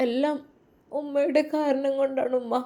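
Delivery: a touch of sobbing through gratitude; sobbing softly. Acted. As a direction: fight back tears as you thank her mother. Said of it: "അവൾ വിതുമ്പിക്കൊണ്ട് പറഞ്ഞു".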